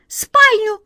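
loud, very high piping squeak; high cartoon mouse voice; squeaky mouse. A narrator's reading. Next mouse gives its word.

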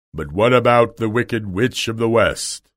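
imposing wizard's interrogation; forceful, booming storybook wizard; Oz's voice. Acted. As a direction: question them as a grand unseen ruler. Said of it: "asked the voice".

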